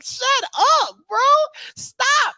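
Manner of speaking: high, slow drawn-out shout; hammed-up shout at a friend; mock yell. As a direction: yell at him to stop, laughing. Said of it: "I was like".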